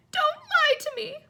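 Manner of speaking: high, loud wailing cry; gasping wail. A narrator's reading. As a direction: wail it through tears. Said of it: "Myrtle gasped".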